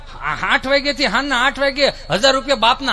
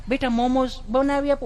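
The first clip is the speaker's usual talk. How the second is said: higher, sweet mother coaxing her son; doting mother; 3 semitones higher, 4 dB quieter than usual. Retold or imitated. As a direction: coo at the little boy like a fond mom. (લાડ કરતી mummy)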